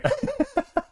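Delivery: squeaky high burst of laughter. Real, not acted. Cracking up at the train wreck. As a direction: break into a high giggle.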